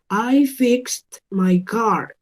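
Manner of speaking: slow model sentence, FIXED as "fikst"; T ending. Shown as a demonstration. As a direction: say "fixed" slowly with a clear final T. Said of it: "Con ese sonido de la T al final".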